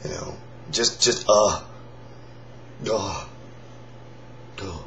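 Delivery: hoarse, raspy near-whisper; forcing air through a worn-out voice; lost voice. Real, not acted. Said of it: "This is me with no voice".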